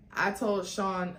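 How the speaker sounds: ordinary delivery, nothing marked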